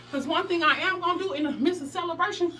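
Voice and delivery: usual speaking voice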